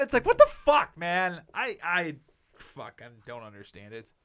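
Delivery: usual speaking voice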